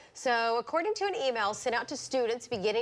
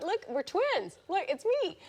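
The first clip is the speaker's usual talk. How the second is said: mock player voice; 9 semitones higher than usual. Voicing the player.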